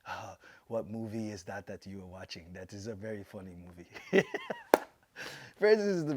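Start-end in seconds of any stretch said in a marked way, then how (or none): 0.0-4.2 low-pitched imitation of an amused fighter; amused Francis Ngannou. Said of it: "Francis starts laughing, chuckles, and just goes". 4.3-4.8 high mock laugh; imitated chuckle. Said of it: "starts laughing, chuckles".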